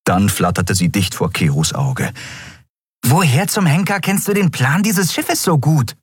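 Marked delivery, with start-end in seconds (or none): 3.1-6.0 high-pitched, exasperated little voice; indignant small creature. A narrator's reading. Nelen confronting Keru up close, indignant. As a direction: demand an answer in a high voice. Fairy-like figure challenges Keru.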